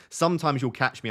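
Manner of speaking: ordinary delivery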